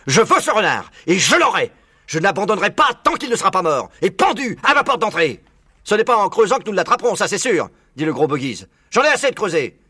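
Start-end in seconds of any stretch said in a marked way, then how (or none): 0.1-5.4 high, loud, vengeful rant; furious. A narrator's reading. Bean vowing to catch the fox, furious. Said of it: "s'écria-t-il furibond". 5.8-7.7 high, loud, quick objection; farmer character voice. A narrator's reading. Fat Bogies objecting to Bean's plan, loud character voice. 8.9-9.8 high, loud complaint; fed-up farmer. A narrator's reading. Bogies sick of digging, fed-up outburst.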